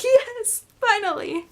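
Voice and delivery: high, squealed relief; hammed-up high-pitched excitement; retold delight. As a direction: re-enact a fan's long-awaited win. Retelling her thrilled reaction.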